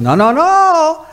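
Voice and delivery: high, slow, stretched-out protest; mock objection. Voicing objectors like Broso.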